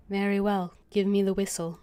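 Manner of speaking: ordinary delivery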